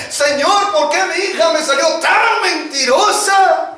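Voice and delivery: mother wailing at heaven; desperate mother praying. Retold or imitated.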